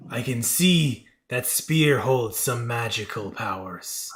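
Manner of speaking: slow, measured creature voice; covetous creature god. Acted. God eyes the player's spear, slow and measured. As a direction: draw it out with godly weight.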